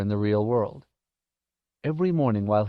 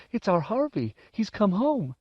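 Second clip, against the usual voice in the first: high-pitched, overcome mother; insistent mother; 10 semitones higher than usual. A narrator's reading. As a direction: insist to husband that it is true. Wife insists her son is back.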